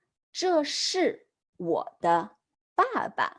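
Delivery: slow, careful repeat of the model; slow model sentence. Shown as a demonstration. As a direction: read it slowly for the class. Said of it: "So she says".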